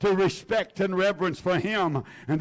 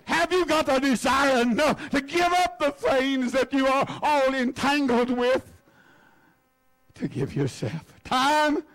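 ordinary delivery

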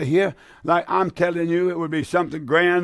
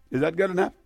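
normal talking voice

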